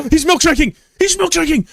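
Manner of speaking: very high, loud shocked shout; shocked yell. Real, not acted. Streamer yelling as rival milkshakes. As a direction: scream it in disbelief at the screen.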